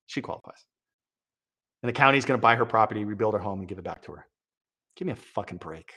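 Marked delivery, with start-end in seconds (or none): 0.1-0.6 breathy aside under the breath; hushed mocking echo; sarcastic whisper. Shown as a demonstration. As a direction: whisper it back in disbelief. Repeats the line, then whispers it.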